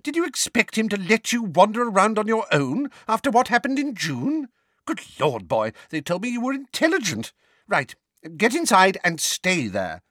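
high, exasperated scolding; impatient. A narrator's reading. Neighbour ordering Harry indoors, impatient. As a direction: scold the boy with fussy impatience. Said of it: "said Missus Figg impatiently".

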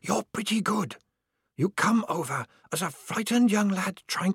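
high, hushed knowing voice; secretive whisperer. Acted. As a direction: confide from the dark. Whisperer figure held voice.

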